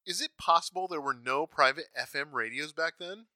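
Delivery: normal talking voice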